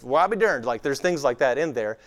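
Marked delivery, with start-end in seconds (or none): 0.0-0.6 quick run-together West Texas twang; West Texas accent. Acted. Grandfather's phrase as found in Bible, West Texas accent. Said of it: "the redneck West Texas equivalent of".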